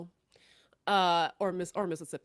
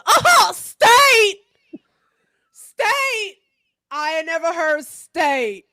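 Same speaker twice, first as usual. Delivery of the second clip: shouting state, much higher and louder; mock outraged shout; 7 semitones higher, 12 dB louder, much slower than usual. Truck man mocks kids' state property claim, shouting. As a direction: scoff at the idea like it's absurd. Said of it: "foghorn leghorn".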